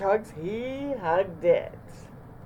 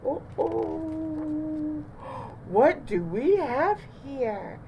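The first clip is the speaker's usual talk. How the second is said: higher, slowed, amused adult voice; surprised librarian; 9 semitones higher, much slower than usual. A narrator's reading. The librarian finds the animals in her library, slow and amused. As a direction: catch them in the act, drawn out. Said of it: "said the librarian".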